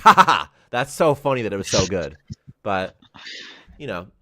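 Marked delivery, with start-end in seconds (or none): 0.0-2.2 loud, laughing mock praise. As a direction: laugh at the kid condescendingly. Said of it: "We're like".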